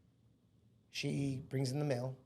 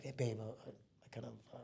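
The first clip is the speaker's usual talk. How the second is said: much softer, trailing, half-whispered admission; sheepish confession; 5 semitones lower, 14 dB quieter than usual. Retold or imitated. Confessing the donation to his wife, sheepish and soft. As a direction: confess it quietly, hoping she won't hear.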